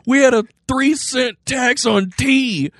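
put-on high squeaky old-timey colonial voice; founding father. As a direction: ham up an outraged founding father. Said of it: "they would have been like".

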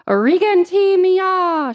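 loud, high, drawn-out cry; prayer woman crying out in tongues; praying in tongues. A narrator's reading. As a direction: call out slowly in a fervent prayer cry.

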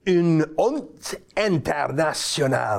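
slow, badly faked French accent; terrible French accent. Shown as a demonstration. Host reads out a Montreal newspaper's words, terrible French accent. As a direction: read it slowly in clumsy French. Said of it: "That's my terrible French accent".

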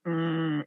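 low mooing "mm"; a low, soft cow moo. Real, not acted. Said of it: "I sounded like a cow".

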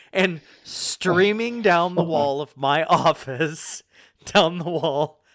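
words broken by laughter; real laughter mid-sentence; laughing while telling. Real, not acted. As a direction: laugh through the disaster.

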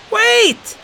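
very high, loud call; cry out. A narrator's reading. Zack calls down from the lookout, loud high cry. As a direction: stop them in their tracks. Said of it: "Zack cried in his best human voice".

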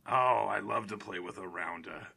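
low, soft character voice; Swordfish. Acted. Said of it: "Hey, Swordfish".

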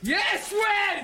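high, loud yes; excited cheer. Real, not acted. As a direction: cheer a teammate on. Cheering relay teammate.

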